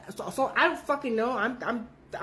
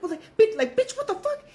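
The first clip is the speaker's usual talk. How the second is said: pitched way up, indignant squawk; knocked over by a friend, shrill disbelief pitched high; shocked outrage; 13 semitones higher, 4 dB louder than usual. Retold or imitated. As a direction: cry out after being shoved over. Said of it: "I'm like".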